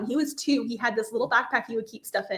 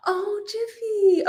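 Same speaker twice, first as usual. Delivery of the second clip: tender aww; 9 semitones higher, much slower than usual. Real, not acted. Host melts at the name Jiffy, tender whisper. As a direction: melt over the name, whisper it slowly.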